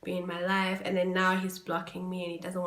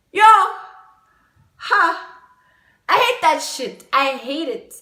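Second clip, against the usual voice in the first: loud angry venting; 8 semitones higher, 12 dB louder than usual. Real, not acted. Venting anger.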